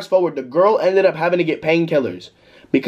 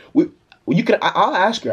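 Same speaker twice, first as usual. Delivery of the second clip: normal talking voice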